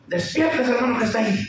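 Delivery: high-pitched angry wake-up call; mock angry. Angry preacher waking a dozing congregation, high mock-angry voice. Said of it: "bien enojado dijo".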